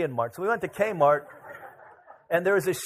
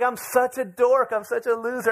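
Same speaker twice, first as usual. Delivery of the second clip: upset self-scolding, pitched up and slowed; 7 semitones higher, 4 dB louder than usual. Retold or imitated. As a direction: wail about being a dork. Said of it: "I was so upset".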